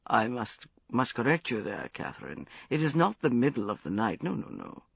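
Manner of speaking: put-on slurred, loose drunken voice; tipsy caller on the phone at dawn. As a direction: sound well into the bottle. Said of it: "Prince, you sound terribly drunk".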